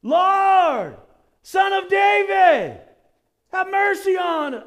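high, loud pleading cry of blind beggars; loud desperate plea for help; desperate shout. Retold or imitated. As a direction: cry out for mercy with everything. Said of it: "they began shouting".

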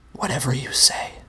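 whispered, dismissive monster reply; hushed and flat. A narrator's reading. Mister Monster answers the narrator's self-reassurance, whispering. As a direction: whisper it back, unconvinced. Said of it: "Mister Monster replied".

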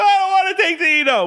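put-on very high, loud childish wail; whining kid. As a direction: whine it out like a scared boy. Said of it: "I'd be".

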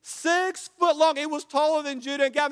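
loud, high, stretched-out preacher's cry; full-force preaching. Acted. Pastor preaching hard to the congregation, loud. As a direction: preach it to the back row.

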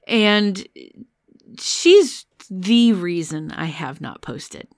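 ordinary delivery

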